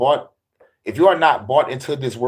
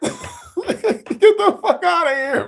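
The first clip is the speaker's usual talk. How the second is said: high, slow laughing dismissal; much slower than usual. Real, not acted. Comic laughing at the heaven bit.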